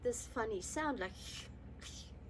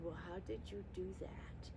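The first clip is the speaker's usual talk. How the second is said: whispered question; 4 semitones lower, 11 dB quieter than usual. Retold or imitated. Father's quiet question retold.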